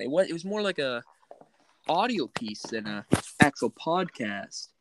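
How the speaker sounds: usual speaking voice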